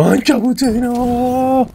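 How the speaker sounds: high slow drawn-out cry; stretched high gleeful call; excited. Real, not acted. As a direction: sing out the discovery. Found enemy hiding.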